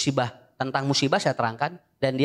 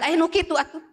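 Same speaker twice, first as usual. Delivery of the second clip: high, laughing exclamation of disbelief; 11 semitones higher than usual. Retold or imitated. Preacher laughing at the crying woman's strange wish. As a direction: laugh through it as if the request is absurd. Teller laughs at odd request.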